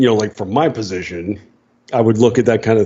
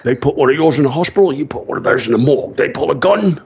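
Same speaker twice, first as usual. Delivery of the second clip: Scottish-accented veteran mentor impression; Sean Connery; 3 semitones higher, faster than usual. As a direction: lay down the rules in a mock Scottish accent, rattled off. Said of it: "he knew that that voice was him".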